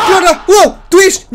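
high, loud startled yell; startled shouting. Real, not acted. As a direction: cry out in sudden alarm. Startled shouting at friend in game.

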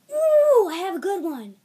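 high, loud whoop; excited realisation. Real, not acted. Vlogger finally getting an idea, excited whoop. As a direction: burst out with a sudden idea.

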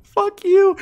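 real high, loud scream of fright. Real, not acted. Player startled by the monster, screaming in real fright. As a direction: shriek at the sudden monster. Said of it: "I don't know why I'm screaming".

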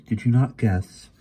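pointed, mildly impatient query; insistent question. A narrator's reading. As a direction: press the point on a child. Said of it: "the pearl's voice insisted".

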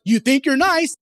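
high, loud mock jab; playful shout. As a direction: toss out the catchphrase high and loud. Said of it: "we shout out".

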